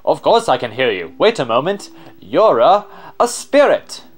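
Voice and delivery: extravagant explorer, amazed and honored; loud, high-swooping astonishment; awestruck explorer. Acted. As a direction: gush like a theatrical adventurer meeting a marvel. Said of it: "give him an extra voice".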